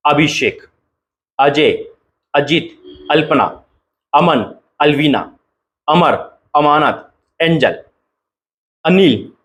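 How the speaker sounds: each name lifted and set apart; name roll call. Acted. Pastor declaring the chosen names to viewers, each one lifted.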